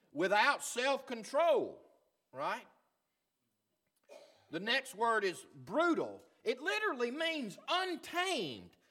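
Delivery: high, emphatic pulpit voice, mostly loud; full-force preaching. Real, not acted. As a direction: hammer the point home from the pulpit.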